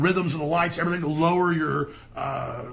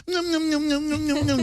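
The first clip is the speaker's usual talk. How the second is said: put-on high babyish nom-nom noises; mocking baby mouth noises; mock baby sucking; 10 semitones higher than usual. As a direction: suck your thumb like a baby. Sucking thumb like toddler.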